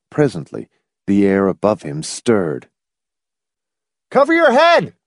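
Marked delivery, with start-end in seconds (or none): 4.1-4.9 high urgent yell. A narrator's reading. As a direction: shout the warning high. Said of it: "Hamilton yelled at McFife".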